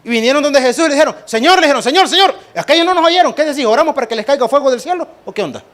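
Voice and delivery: más agudo, fuerte y rápido, atropellado; discípulos acelerados. Pretend. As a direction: como discípulos que no aguantan más. Discípulos acelerados piden fuego. (discípulos exaltados pidiendo fuego del cielo)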